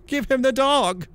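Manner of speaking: put-on boys in chorus. Boys giving up the dog, high loud chorus. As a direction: shout it like a gang of kids. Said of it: "together said".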